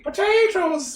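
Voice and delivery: goblin "potatoes", drawn out and Irish; high creature voice with an Irish twang; weird Irish-sounding voice. Shown as a demonstration. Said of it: "in a weird voice without it sounding racistly Irish".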